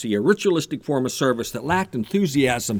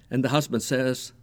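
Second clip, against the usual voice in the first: ordinary delivery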